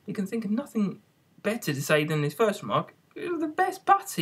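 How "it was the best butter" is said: very high, meek little voice; high timid hare voice; meek March Hare. A narrator's reading. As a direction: squeak it out meekly, very high. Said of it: "looked at it gloomily".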